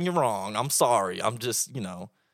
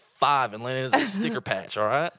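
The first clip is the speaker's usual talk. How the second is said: louder Oklahoma cowboy storytelling; mock Oklahoma accent; 4 semitones higher, 6 dB louder than usual. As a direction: tell it like a proud Oklahoma cowboy. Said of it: "this is where the accent comes out".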